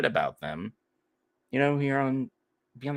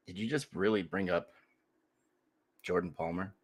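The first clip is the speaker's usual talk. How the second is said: mock disbelief; 2 semitones lower, 8 dB quieter than usual. Real, not acted.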